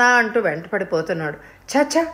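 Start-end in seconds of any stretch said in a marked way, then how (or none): 1.7-2.1 higher, irritated "ఛ, ఛ"; annoyed tut. A narrator's reading. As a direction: brush it off in irritation. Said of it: "అంటూ విసుక్కుంది". (విసుగ్గా "ఛ, ఛ")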